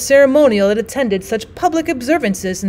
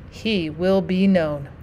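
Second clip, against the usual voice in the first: slow and much softer, almost to himself; softer, slower last echo of the vow; quiet ominous echo; 4 semitones lower, 7 dB quieter, much slower than usual. Acted. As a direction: let the vow sink to a low, drawn-out murmur. Stranger's vow fading to quiet.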